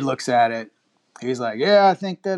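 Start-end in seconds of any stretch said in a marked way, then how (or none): 1.6-2.4 higher and louder, a mock country kid; retold mechanic, higher and louder; voicing the young mechanic. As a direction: lightly put on a young mechanic's voice. Young mechanic voiced in the story.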